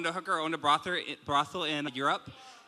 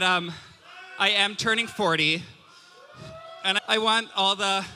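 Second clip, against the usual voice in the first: ordinary talk, nothing marked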